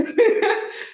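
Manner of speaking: short breathy laugh, high; burst of laughter. Real, not acted. To the class about being sick, a breathy laugh. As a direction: laugh out at her own joke.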